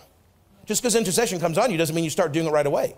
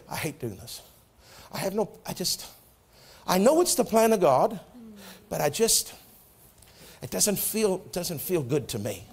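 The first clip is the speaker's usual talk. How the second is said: weary reluctant murmur; 2 semitones lower, 5 dB quieter than usual. Retold or imitated. Retold weary phone calls to wife.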